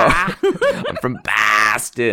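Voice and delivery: put-on very slow, stretched Boston accent; mock Boston accent. Host repeating the local's boast, exaggerated Boston accent. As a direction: lean hard on a broad Boston vowel. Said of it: "they go "Boston" whenever they say it".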